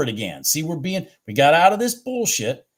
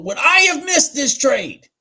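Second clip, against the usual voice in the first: higher and louder, worked-up question; excited, raised rhetorical question; 11 semitones higher, 6 dB louder than usual. Real, not acted. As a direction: throw the question out, fired up.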